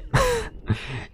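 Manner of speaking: high squeaky laugh bursting out; a quick real giggle that jumps up high; burst of laughter. Real, not acted. Laughing at a loud survivor.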